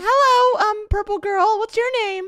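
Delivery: put-on high falsetto girly voice; man doing a woman's voice. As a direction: squeak it up high like a girl. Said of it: "Get your girl voices ready".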